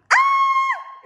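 very high, loud shriek of delight; excited scream. Real, not acted. Seeing the dragon poster, very high loud scream. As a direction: scream with joy.

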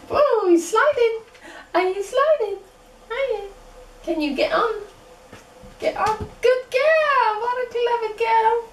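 high, bright praise for a puppy; squeaky praising a dog. Acted.

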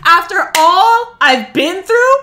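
outraged yelling at a game; indignant outburst. Real, not acted. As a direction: build from a stretched, loud protest to a high yell. Outraged at the game's jump scare.

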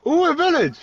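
high squealing ooh; mock excitement. Mock delight at village.

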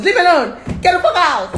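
high, loud outburst; acted angry dismissal. Angry girlfriend ordering him out, loud. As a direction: play a woman throwing someone out. Voicing angry woman in example.

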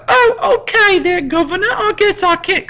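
put-on high, chirpy Cockney urchin accent; British kid accent. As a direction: squeak it out like a British street kid. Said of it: "'cause they're British".

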